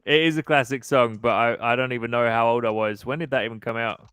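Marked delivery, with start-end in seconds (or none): none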